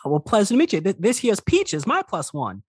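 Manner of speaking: high-pitched cowboy halfling voice; folksy halfling sheriff. Acted. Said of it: "the halfling kind of turns to face y'all".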